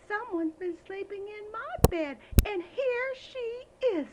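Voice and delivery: high, loud excited little bear; high, loud baby bear finding the girl. Acted. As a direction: squeal out the discovery like a cub. Baby Bear voiced in story.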